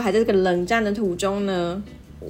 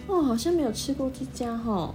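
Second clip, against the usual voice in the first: mom's casual aside, a touch softer; offhand remark; 5 semitones higher, 6 dB quieter than usual. Retold or imitated. Mom ignoring dad during the silent treatment, offhand and soft. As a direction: say it lightly, as if nothing happened. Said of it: "我妈在那边淡淡的说".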